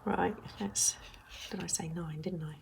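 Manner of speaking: whispered self-check; hushed question to herself. Real, not acted.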